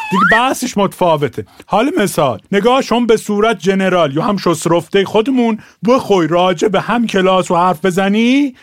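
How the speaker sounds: ordinary talk, nothing marked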